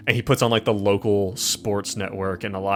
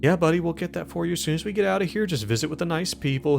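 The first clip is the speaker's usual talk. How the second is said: high, quick humoring patter; placating; 7 semitones higher, faster than usual. Acted. Orderly promising things to a restrained patient, placating. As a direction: brush him off sweetly in a raised voice. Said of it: "like, placating him".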